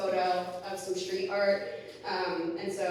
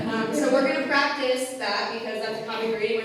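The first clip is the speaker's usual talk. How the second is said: normal talking voice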